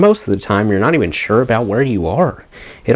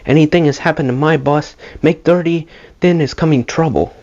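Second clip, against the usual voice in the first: put-on Finnish accent, higher and stern, broken English; firm Finnish accent; 7 semitones higher than usual. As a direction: imitate the firm Finnish bus driver's accent. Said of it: "in a very firm Finnish accent".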